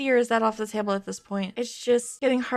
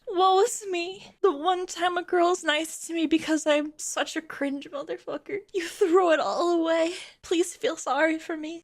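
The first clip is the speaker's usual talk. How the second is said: higher, hammed-up pity-me whine; mock the sad lonely man, pitched up; mock whiny self-pity; 6 semitones higher than usual. Mocking the man's texts with a higher whiny voice.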